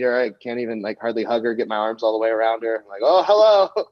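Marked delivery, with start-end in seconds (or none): 3.0-3.9 higher, louder put-on hello; exaggerated polite greeting; mock greeting. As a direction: act out an awkward, over-cheery hello. Awkward hug greeting, acted out.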